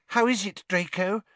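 high-pitched, sugary simper. A narrator's reading. Pansy fussing over injured Draco, simpering. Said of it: "simpered Pansy Parkinson".

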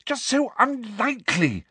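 high-pitched, faltering plea; desperate. A narrator's reading. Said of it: "said Hermione desperately".

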